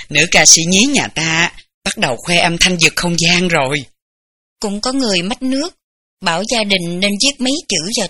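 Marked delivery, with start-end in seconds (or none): 0.0-3.8 laughs, then a low, louder teasing line; joking laugh. Acted. Mother joking over her crying baby, with a laugh. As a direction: chuckle and tease the crying baby. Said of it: "bà luôn khôi hài bảo".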